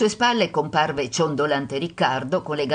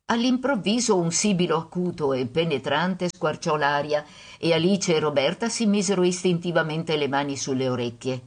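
ordinary delivery, nothing marked

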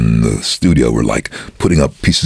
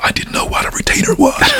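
whisper. Real, not acted. Unvoiced whisper aside.

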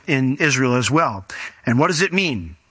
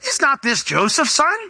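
much higher, doubting question; sceptical townsman; 10 semitones higher than usual. Acted. Pastor voices the doubters in the synagogue, high and sceptical. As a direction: sneer it like a know-it-all neighbour. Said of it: "some group of people said".

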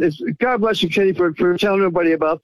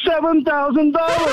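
elated; 8 semitones higher than usual. Real, not acted. Caller telling host his free silver total, elated. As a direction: crow the figure high, then explode on the last word.

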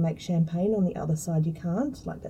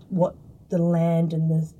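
ordinary delivery

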